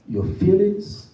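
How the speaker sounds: ordinary delivery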